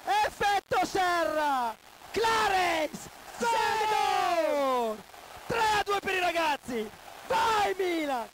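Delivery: screaming goal celebration; breathless italian goal commentary. Real, not acted. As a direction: ecstatic fan yelling for the winner. Seedorf winner celebration.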